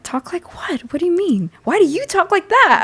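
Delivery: high, loud mock indignation, replaying it; combative comeback. To a classmate who mocked her voice, retorting high and combative. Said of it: "I was, like, so combative".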